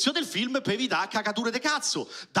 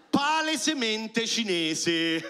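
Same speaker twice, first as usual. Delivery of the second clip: ordinary delivery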